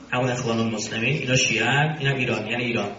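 normal talking voice

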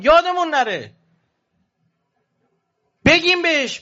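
rising fervent sermon appeal; impassioned preaching. Acted. Preaching run, pitch raised.

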